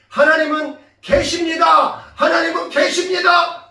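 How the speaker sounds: loud, high proclamation; emphatic declaration. Retold or imitated. God's answer to mother and child, emphatic shout. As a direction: shout it with full conviction.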